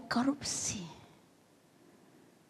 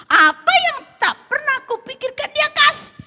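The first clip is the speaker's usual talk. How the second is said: high, loud acted exclamation; excited realisation; 13 dB louder than usual. Wife reasoning that God is good, high excited cry. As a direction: proclaim it as a sudden discovery. Wife reconsiders with sudden delight.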